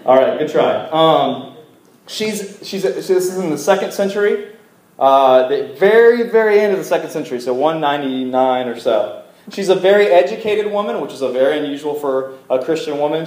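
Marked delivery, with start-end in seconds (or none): none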